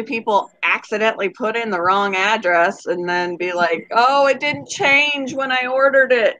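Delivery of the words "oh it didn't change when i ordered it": drawn-out, higher innocent plea; feigned innocence. Acted. Customer to driver about wrong address, feigned innocence. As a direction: play dumb, slow and lilting. Acting a fake innocent excuse.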